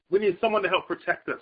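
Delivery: usual speaking voice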